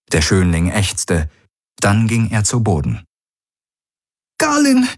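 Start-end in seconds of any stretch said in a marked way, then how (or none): 4.4-4.9 choked voice. A narrator's reading. Kirai cries out to Galen, choked and high. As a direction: cry his name through a tight throat. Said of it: "rief Kirai mit erstickter Stimme".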